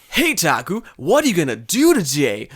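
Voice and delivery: high, loud textbook greeting; over-enunciate like a bad listening test; English lesson recording. Girl on recording asking Taku, exaggerated textbook intonation. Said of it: "It was like".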